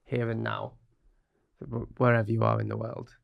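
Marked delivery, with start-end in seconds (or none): none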